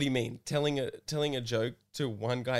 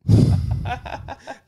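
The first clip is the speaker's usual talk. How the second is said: high burst of laughter; 9 semitones higher, 7 dB louder than usual. Real, not acted. Reacting to story.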